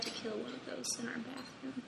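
quiet unvoiced whisper. Real, not acted. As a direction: breathe it with no voice.

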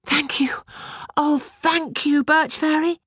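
high, glad thanks; joyful cry. A narrator's reading. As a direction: call out gratefully, high and bright. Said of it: "Batushka cried".

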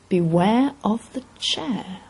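slow, drawn-out ominous warning. A narrator's reading. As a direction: stretch out each word like an omen. Sudden realisation of the warning message.

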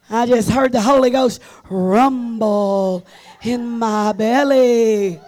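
loud, slow, booming preacher; preaching at full force. Real, not acted. Preacher booming the Holy Ghost to the church. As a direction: thunder it out to the congregation.

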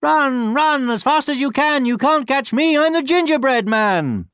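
high, loud sing-out taunt; gleeful high-pitched storybook boast; taunting gingerbread man. A narrator's reading. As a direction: taunt pursuers in a high little voice. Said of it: "just laughed and said".

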